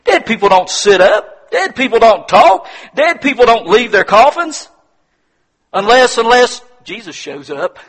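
loud, high, emphatic repetition; full-force preaching. Real, not acted. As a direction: hammer each phrase home to the congregation.

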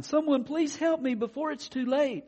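high, loud mock plea; crying out for help. Stuck unicorn begs passerby for rescue, crying out. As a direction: plead like a stuck storybook unicorn.

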